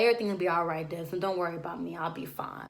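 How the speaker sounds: soft, low, fading calm; hushed reassurance. Retold or imitated. Dean soothing Desmond before leaving, in a soft, fading voice. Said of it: "she's like".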